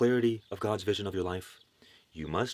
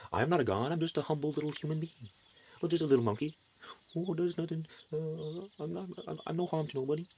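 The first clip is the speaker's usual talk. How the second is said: higher, softer, timid self-belittling voice; meek humble believer; 5 semitones higher, 3 dB quieter than usual. Pretend. Mocking the believer who resists being divine, meek timid voice. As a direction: shrink away like a small humble nobody.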